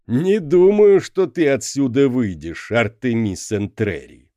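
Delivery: loud, high, otherworldly proclamation; booming idol voice. A narrator's reading. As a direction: proclaim like a magic statue. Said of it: "провозгласил он".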